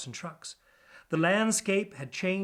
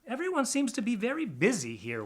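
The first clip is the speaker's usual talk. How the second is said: high, puzzled little rat voice; lightly voiced pack rat; 9 semitones higher than usual. DJ tells Wendover about the busy city, high-pitched. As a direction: wonder aloud at the busy city.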